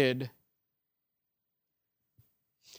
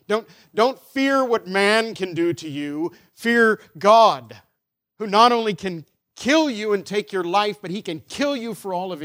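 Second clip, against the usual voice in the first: loud, raised preacher's warning; urgent pulpit warning, pitched up; forceful preaching; 5 semitones higher, 6 dB louder than usual. Real, not acted. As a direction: preach it with hard, weighty stresses.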